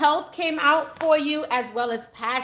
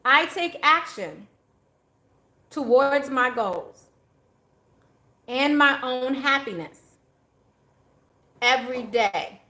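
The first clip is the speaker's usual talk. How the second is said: slow affirmation with pauses between phrases; affirmation reading; slower than usual. Shown as a demonstration. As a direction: read it slowly so they can take it in. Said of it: "the affirmation associated with this is about".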